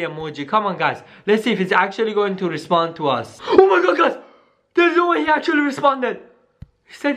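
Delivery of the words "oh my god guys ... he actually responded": excited. Real, not acted. Reads Sonic's surprise reply.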